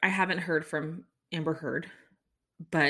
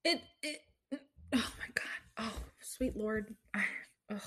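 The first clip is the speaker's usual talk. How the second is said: horrified whisper; 3 semitones higher, 9 dB quieter than usual. Real, not acted. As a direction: whisper it as if you can't believe it. Disgusted realization about the DNA.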